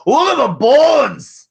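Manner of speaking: put-on loud, high Scottish accent. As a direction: shout it in a hammy Scottish brogue. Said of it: "in her best Scottish accent goes".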